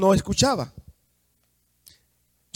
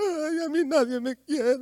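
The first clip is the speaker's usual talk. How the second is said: mock crying; 7 semitones higher, 3 dB quieter than usual. Blind man who could have stayed crying, mock crying. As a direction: whine it out, badly faked tears. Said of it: "en lugar de callarse y quedarse llorando".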